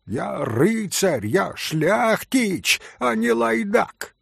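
pompous Polish knight. Acted. Пан напыщенно, польский акцент.

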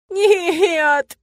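high, loud drawn-out wail; long howl of a single word. A narrator's reading. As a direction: wail it miserably. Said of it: "снова провыла я".

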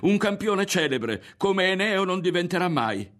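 high, loud, fervent boast; about to shout. A narrator's reading. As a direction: proclaim it defiantly. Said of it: "stava per gridare".

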